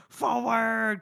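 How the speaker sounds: high, hammed-up rallying shout; rally the troops, tongue in cheek; mock heroic battle cry. Heroic cry quoted from book.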